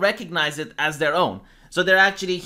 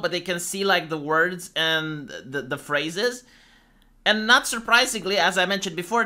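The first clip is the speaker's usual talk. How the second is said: ordinary delivery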